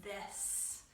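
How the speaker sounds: this, breathed without voice; whispered hint. Shown as a demonstration. Teacher whispering a hint to the class. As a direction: whisper the word as a clue. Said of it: "T H I S".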